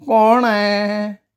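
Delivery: put-on high, drawn-out old man calling from inside; old man's voice. A narrator's reading. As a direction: stretch it out high like an old fellow. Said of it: "एका म्हाताऱ्याचा आवाज ऐकू आला".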